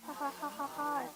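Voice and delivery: hammed-up villain cackle; evil laugh.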